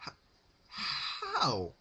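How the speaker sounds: high, breaking stammer; stammered fear. A narrator's reading. Rarity stammering to Pinkie, frightened. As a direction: trip on the word in fear.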